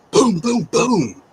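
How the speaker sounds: loud high door-pounding booms; knocking imitation. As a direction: bang out the knocks with your voice.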